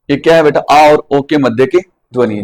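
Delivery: usual speaking voice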